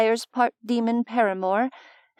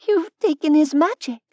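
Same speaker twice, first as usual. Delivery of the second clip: plain reading voice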